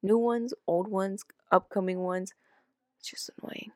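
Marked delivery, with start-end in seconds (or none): 3.0-3.7 a whispered aside, barely voiced. Real, not acted. Co-host finishing a rant about associates, whispered. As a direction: drop to a breathy whisper to close the rant.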